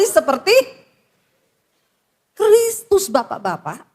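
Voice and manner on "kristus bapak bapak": usual speaking voice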